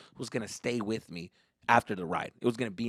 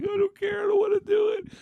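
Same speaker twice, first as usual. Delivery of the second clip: very high tearful mock wail; whiny refusing child; 4 dB louder than usual. Kid to sister before ride, high whiny refusal.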